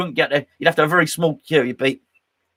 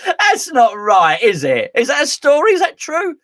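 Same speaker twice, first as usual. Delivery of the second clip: incredulous; 10 semitones higher, 5 dB louder than usual. Real, not acted. Incredulous questions.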